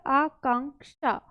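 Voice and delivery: Akanksha said slowly, each syllable clear; teacher modeling the full word; slow careful word. Shown as a demonstration. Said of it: "अनुस्वार है यहाँ पर".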